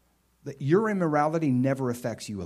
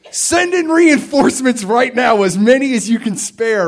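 much louder and higher, slow urgent call; urgent call for backup; 12 semitones higher, 15 dB louder, slower than usual. Retold or imitated. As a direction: shout for reinforcements like a soldier. Humbly calling for backup.

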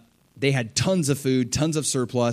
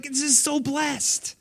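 much higher, slow, dreamy self-satisfied voice; mock smug Joseph; 13 semitones higher, slower than usual. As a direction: coo it as a self-pleased show-off. Mocking a self-centered Joseph.

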